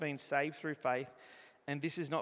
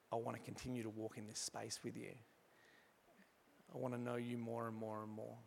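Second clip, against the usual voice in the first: soft prayer; 3 semitones lower, 8 dB quieter than usual. Acted. Model prayer offered to the congregation.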